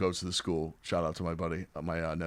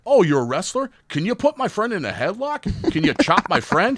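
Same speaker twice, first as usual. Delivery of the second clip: high, loud, gushing ask; eager fan question; 10 semitones higher, 8 dB louder than usual. Retold or imitated. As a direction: pester him like an excited fan. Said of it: "they're like".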